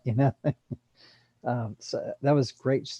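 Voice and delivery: usual speaking voice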